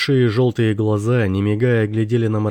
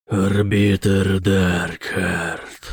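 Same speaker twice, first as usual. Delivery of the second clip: demon voice; much slower than usual. A narrator's reading. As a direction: drag each syllable like a demon. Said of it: "произнёс демон".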